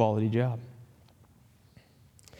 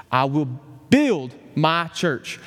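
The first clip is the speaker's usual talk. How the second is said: "build" stretched out slowly and stressed; slower than usual. Retold or imitated. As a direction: lean hard on the word "build". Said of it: "emphasize a different word of that promise".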